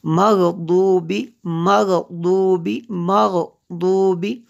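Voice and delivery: मग़दूबी chanted with a heavy emphatic D; Tajweed मग़दूबी with full Arabic dad; correct dad sound. Shown as a demonstration. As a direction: recite मग़दूबी syllable by syllable, thick D, no Z. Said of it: "इसको दाद पढ़ना".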